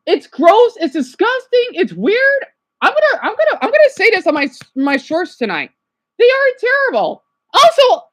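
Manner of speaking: high, loud, fed-up outburst; upset rant. Real, not acted. Upset vlogger ranting at online commenters, high and loud. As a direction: let the disgust burst out. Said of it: "I'm just so upset what they said".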